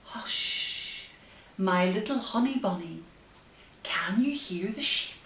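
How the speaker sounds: gentle sing-song rhyme reading; reading to children. Acted. Bedtime reading the rhyme aloud to her baby. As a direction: read the rhyme like a lullaby.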